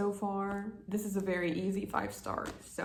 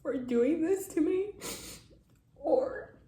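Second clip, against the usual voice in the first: louder, tearful; tearful and choked; crying; 5 semitones higher, 4 dB louder than usual. Real, not acted. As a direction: push it out through sobs. Crying about author.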